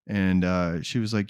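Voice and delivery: usual speaking voice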